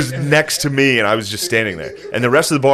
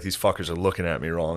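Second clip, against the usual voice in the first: put-on lower, softer drunken grumble; mock drunk tough; 6 semitones lower, 9 dB quieter than usual. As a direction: mutter it like a picky drunk. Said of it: "He's like".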